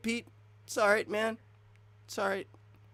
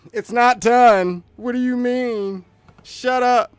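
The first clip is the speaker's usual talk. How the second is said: loud, high, drawn-out imitation of outraged people; mock angry retort; 8 semitones higher, 12 dB louder, slower than usual. Mimicking people arguing back about unfinished water physics, mock angry shouting. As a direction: shout it back like an indignant commenter.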